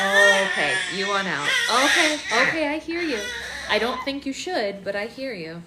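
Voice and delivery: loud soothing over a scream. Real, not acted. Mom loudly reassuring screaming baby.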